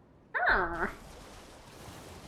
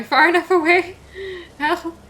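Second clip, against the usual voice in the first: panicked shout; 10 semitones higher, 8 dB louder than usual. Real, not acted. Fleeing boss in panic.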